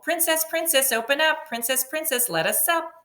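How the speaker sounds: high sing-song frog calling at the door. Acted. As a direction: call through the door in a high voice. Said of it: "Someone called".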